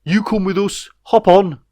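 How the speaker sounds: high, loud creature invitation; hopper creature voice. A narrator's reading. Hopper inviting Jeff and Ray aboard, high and loud. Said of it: "beckoned to Jeff and Ray".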